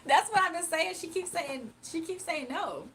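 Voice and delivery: loud high laugh. Real, not acted. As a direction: burst out laughing, then keep telling it. Laughing about being told no.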